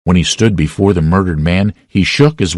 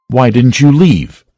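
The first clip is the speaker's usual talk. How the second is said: accusing question; 6 semitones higher, 3 dB louder than usual. A narrator's reading. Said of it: "somebody said".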